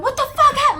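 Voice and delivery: high, loud alarmed mother; alarmed mom. Retold or imitated. Mom asking her daughter what happened, high and loud. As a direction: voice mom demanding answers, up high.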